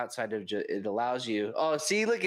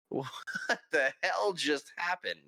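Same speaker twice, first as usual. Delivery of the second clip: slow, higher-pitched bafflement; stunned disbelief; 5 semitones higher than usual. Retold or imitated. Viewer reacting to an odd in-game proposition, stunned disbelief. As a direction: draw it out, baffled.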